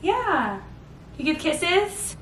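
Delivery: high, sing-song cooing at the babies. Real, not acted. Mother cooing to her baby girls about kisses, high sing-song.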